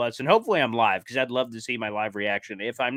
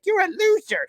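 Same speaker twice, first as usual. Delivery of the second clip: put-on girlfriend's insult; 5 dB louder than usual. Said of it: "And she's like".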